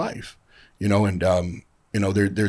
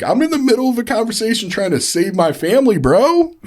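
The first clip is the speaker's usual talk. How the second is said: very high, loud re-enacted rant; freaking out; 7 dB louder than usual. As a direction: shout in exasperation at a meddling best friend. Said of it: "I was so mad, freaking out".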